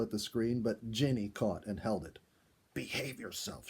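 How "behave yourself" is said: soft hushed rebuke; whispered scolding. A narrator's reading. Ginny holding Sam's finger, a soft hushed rebuke. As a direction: hush him gently, softer. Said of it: "she whispered".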